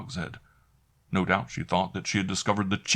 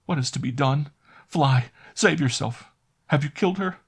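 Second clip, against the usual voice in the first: panicked maid; 8 semitones higher, 3 dB louder than usual. A narrator's reading. Maid urging Caspar to flee, panicked. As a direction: beg him to run. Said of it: "cried Annette".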